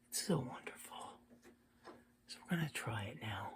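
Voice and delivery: hushed, secretive whisper. Real, not acted. Hushed whisper before tasting.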